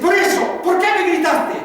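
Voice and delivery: high, loud, insistent shout; acted furious father yelling; angry father. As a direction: hammer the accusation home at full volume. Acted father shouting back at son.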